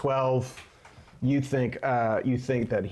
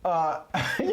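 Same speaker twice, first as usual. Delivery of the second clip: usual speaking voice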